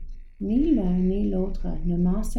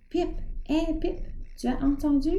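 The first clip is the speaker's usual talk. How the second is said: stupéfait; 8 semitones higher, faster than usual. Acted. Georges stupéfait interpelle Pip.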